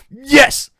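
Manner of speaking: sudden high, loud burst of joy; excited yell. Real, not acted. Streamer cheering the drop, excited yell. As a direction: shout it out, overjoyed. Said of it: "I got too excited".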